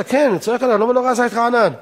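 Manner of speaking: high, insistent rebuke from a rabbi; retold scolding shout. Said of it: "מה שהרב צועק עליי עכשיו". (נזיפה בקול מורם וגבוה, בחיקוי)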